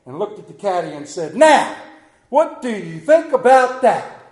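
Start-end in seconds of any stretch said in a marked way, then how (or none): 1.3-4.2 higher, louder, slow triumphant challenge; confident gloat. Acted. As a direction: crow over the great shot.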